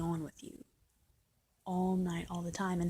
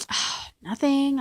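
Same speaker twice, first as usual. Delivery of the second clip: slow, higher, put-upon reply; mock sulky sigh; 6 semitones higher, 7 dB louder, much slower than usual. Girlfriend answering boyfriend's hello, mock sulky.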